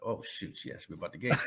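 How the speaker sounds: whispered to himself in dread; a panicked private whisper, mother can't hear. Retold or imitated. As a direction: hush it, caught off guard.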